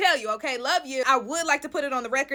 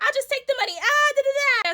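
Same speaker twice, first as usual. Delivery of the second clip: much higher, teasing sing-song; sing-song taunt; 13 semitones higher, 5 dB louder than usual. Pretend. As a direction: hand the money over breezily, humming. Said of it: "I was like".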